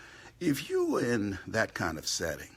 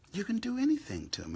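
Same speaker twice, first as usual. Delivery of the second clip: pitched up fast with disbelief; higher rising indignation; 14 semitones higher, faster than usual. Real, not acted. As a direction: lift it high in outrage. Repeated higher.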